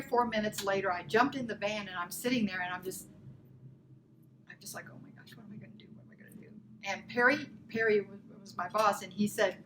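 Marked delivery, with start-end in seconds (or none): 4.5-6.5 barely audible, breathy panicked whisper; hushed dismay. Retold or imitated. Woman in the van fretting to herself, hushed dismay. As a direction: murmur it to yourself, overwhelmed.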